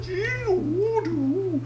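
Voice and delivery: high breathy woo sounds of a bender; mock Tai Chi whoosh. Imitating benders' Tai Chi noises, mock whoosh. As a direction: whoosh like a martial artist moving.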